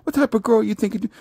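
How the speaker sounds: imitating a judgmental woman; mocking women viewers. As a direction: squeal it up like a scornful woman. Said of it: "Right now, you like".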